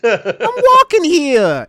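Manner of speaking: laughing mock New Yorker, stretched out slow; jokey New York cabbie accent, slowed right down. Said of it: "As I say in my New Yorker accent".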